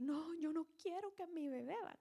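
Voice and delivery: mild motherly protest; Mary's lament. Retold or imitated. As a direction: plead like a worried mother. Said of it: "Empieza a decir".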